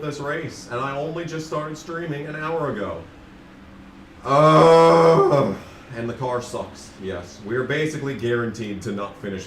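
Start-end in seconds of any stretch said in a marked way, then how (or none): 4.2-5.6 loud exasperated ugh; frustrated groan. Real, not acted. Frustrated at race situation.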